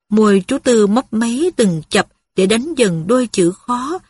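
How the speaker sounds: ordinary delivery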